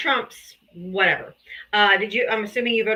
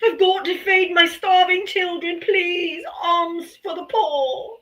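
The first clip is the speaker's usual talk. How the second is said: much higher, slow whining beggar plea; theatrical beggar; 8 semitones higher, slower than usual. Pretend. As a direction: overact a starving beggar begging for alms. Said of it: "Do you like my theatrics?".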